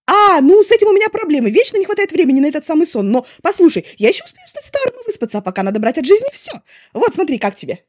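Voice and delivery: loud, high, bubbling with plans; eager chatterbox. A narrator's reading. Wei Ying pitches fun plans to Lanzhan, loud and eager. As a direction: pour out every idea at once.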